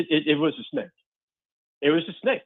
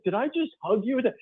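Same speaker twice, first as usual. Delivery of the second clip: higher, disbelieving question; mock alarm; 10 semitones higher, 3 dB quieter than usual. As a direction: ask it half-horrified, half-laughing. Retold shocked question.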